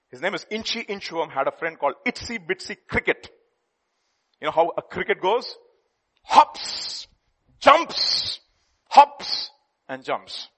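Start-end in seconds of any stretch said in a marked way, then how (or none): 6.2-10.5 bouncy hops. Acted. Preacher acting out the cricket, loud and bouncy. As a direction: bounce each word like a cricket jump.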